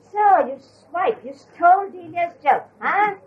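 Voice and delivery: high, slow, teasing mock reproach; mock accusation. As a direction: scold her playfully, half wailing. [name] mock-accuses Norina.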